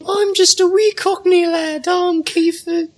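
very high squeaky little Cockney lad; hammed-up high Cockney boy voice; Cockney small boy voice. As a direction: pitch way up and play a wee Cockney urchin. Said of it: "That's actually the exact voice that Helix has".

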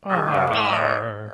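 soft, drooping pirate arr; dejected arr. Pretend. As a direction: let the arr sag sadly. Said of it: "Dejected R".

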